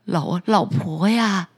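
low, stammering scared fisherman; frightened stammer. Acted. Fisherman scared by his wife's fierce face, stammering. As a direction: stumble over the word in fright. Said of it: "丈夫被他凶狠的表情吓坏了".

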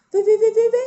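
high, light flutter of repeated words; high-pitched storybook flitting; tiny bug flying. A narrator's reading. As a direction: chirp it like a tiny flying insect. Imitates tiny insect flying.

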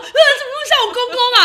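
high, loud, fast laughing cry; dismayed laughing wail. Retold or imitated. New mother seeing her son look like the father-in-law, laughing dismay. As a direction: wail it in mock despair, laughing. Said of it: "超难过".

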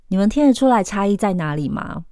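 ordinary talk, nothing marked

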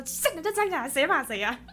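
defiant shout. Retold or imitated. Retelling standing up to a strict teacher, mock defiant yell. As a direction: throw the challenge back half-laughing.

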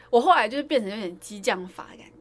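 normal talking voice

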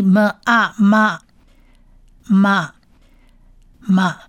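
么 plus 啊 joined into 嘛, "ma"; blended syllable. Shown as a demonstration. Teacher blends sounds for learners, 嘛 distinct and separate. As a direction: sound out 么, 啊, then repeat 嘛 with pauses. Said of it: "men在这边发么的音".

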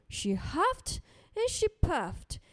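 plain reading voice

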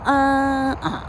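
啊 sung up high on one note. Shown as a demonstration. Teacher sings the high syllable in a stress drill. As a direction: lift 啊 to a high pitch. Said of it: "第二个音比较高".

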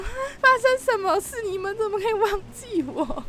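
lightly voiced bewildered protest; dismayed disbelief. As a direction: replay the moment you realise they left you. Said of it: "当下一种".

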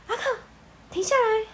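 high, urgent call to stop; alarmed, insistent plea; urgent young woman. Acted. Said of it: "艾莎突然要求阿克停下雪橇". (急切的年轻女声)

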